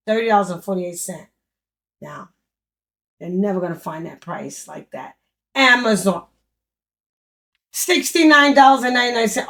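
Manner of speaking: ordinary talk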